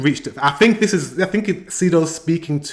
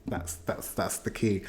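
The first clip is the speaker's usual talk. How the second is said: breathy near-whisper, much softer; hushed; 5 semitones lower, 13 dB quieter than usual. Real, not acted. Marking the lyric's key point, in a hushed whisper. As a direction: drop it to a hush for emphasis.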